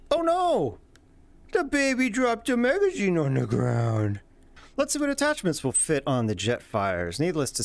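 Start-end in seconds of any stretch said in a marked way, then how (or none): 0.1-4.2 high squeaky mock alarm; mock dismay. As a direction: play it as silly fake panic. Mock baby voice joke.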